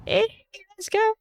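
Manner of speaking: sudden high cheer of joy; excited cheer. Real, not acted. Player cheering the verdict, high excited whoop. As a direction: shout it up like a win.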